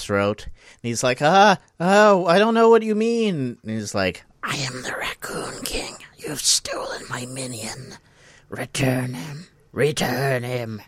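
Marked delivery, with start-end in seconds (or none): 1.2-3.6 high, loud mock panic; panicked protest. Jeb grasped by ghostly paws, high panicked cry. 4.4-10.8 ghostly, menacing whisper; soft, eerie whisper, slowing on the last demand; whispery Raccoon King. Retold or imitated. Raccoon King to Jeb at his throat, spectral whisper. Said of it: "there's a whispery voice".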